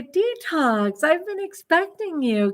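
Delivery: "Detox" high with a falling close, like a movie villain who saw it coming; Tanny greets the detox as a sly mock villain; knowing villain greeting. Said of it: "And she'll say".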